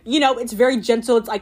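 ordinary talk, nothing marked

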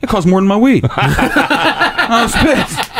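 high-pitched laugh breaking through the words. Real, not acted. Telling friends about a stale blunt, laughing. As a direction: laugh out the outrage as you tell it.

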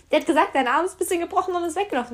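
high, fast, loud complaint; retold exasperated protest; indignant. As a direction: rush out an aggrieved explanation. Indignant retort to the helper.

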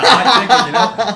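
loud, high, gleeful burst; overexcited. Real, not acted. Reviewer blurting out about the hot chicken, loud and giddy. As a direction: belt it out with goofy glee. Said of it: "I don't know why I said it like that".

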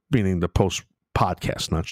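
usual speaking voice

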